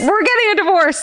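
high, half-yelled outburst; mock yell. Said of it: "I almost yelled at Steven".

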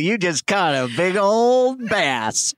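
put-on folksy Southern accent, stretched out. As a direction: drawl it like a Southern angler. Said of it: "I did the accent".

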